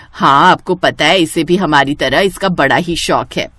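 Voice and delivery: ordinary delivery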